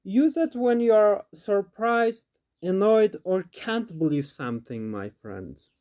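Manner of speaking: ordinary talk, nothing marked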